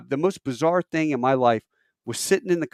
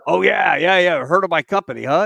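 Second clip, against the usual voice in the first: loud, higher, pleased acknowledgement; imitated hearty reply; 4 semitones higher, 7 dB louder than usual. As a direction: play a boss glad to be recognized. Acted hearty reply to recognition.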